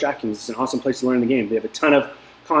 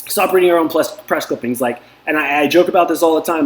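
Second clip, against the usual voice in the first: ordinary talk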